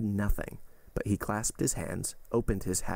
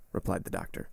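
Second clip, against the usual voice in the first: plain reading voice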